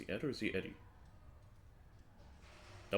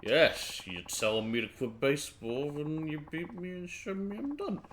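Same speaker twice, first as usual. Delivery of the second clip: put-on slurred drunken challenge, rising higher; 5 semitones higher, slower than usual. Drinker wagers quitting baseball with his buddy, drunk. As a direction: play it slow and sloshed. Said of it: "especially seeing how drunk you are".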